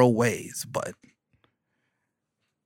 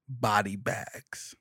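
normal talking voice

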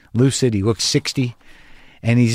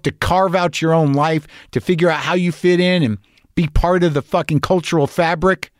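higher-pitched, driving tirade; heated monologue building to an insult; scornful rant; 6 semitones higher than usual. Real, not acted. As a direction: rail at them in a raised pitch.

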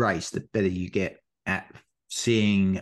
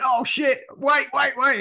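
much higher, loud panicked call; retold shout; 3 dB louder than usual. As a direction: yell at a mate to stop. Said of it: "he shouted at him".

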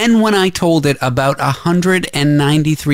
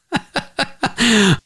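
high laugh; 10 semitones higher than usual. Real, not acted. Laughs after the poem.

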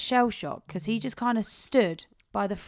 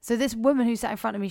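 ordinary delivery

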